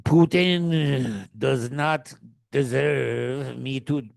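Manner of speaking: very slow, lightly accented mock Zelensky; Zelensky impression. Mocking Zelensky's defiance of Putin, slow and lightly accented. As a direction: drag it out slowly like a solemn Zelensky with a Mexican-sounding accent. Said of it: "He's like".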